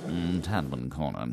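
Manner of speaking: low, slow mimicry of a train announcer; train announcer imitation. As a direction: draw it out low like the station announcement. Mimicking the announcer just played.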